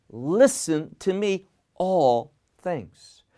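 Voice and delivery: "Listen to me, all things" in separate, weighted pieces; choppy emphasis. Retold or imitated. Preacher quoting Messiah's choppy original wording, emphatic and broken up. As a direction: break before and after "all", landing firmly on it. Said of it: "it's very choppy in the original language".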